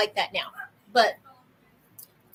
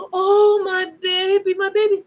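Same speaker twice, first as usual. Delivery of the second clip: much higher and louder, hammed-up cooing worry; mock fretting mom; 8 semitones higher, 5 dB louder than usual. Mother mocking a fussing parent over a fallen child, hammed-up cooing. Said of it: "I don't do the".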